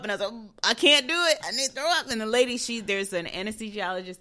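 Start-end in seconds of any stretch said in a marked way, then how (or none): 0.6-2.1 high, loud helpless whine; distressed plea. Retold or imitated. Patient telling nurse she feels sick, acted distress. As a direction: re-enact a desperate complaint, pitched up.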